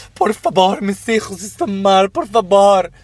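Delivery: súplica lastimera mucho más aguda, lenta; mendigo teatral. Retold or imitated. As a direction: imitando a un mendigo que exagera su pena. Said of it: "En plan". (imitando al mendigo del metro, súplica aguda y teatral)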